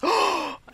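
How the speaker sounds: high shocked gasp; a sudden sharp intake of breath; sister's imitated gasp of shock. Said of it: "I just heard this".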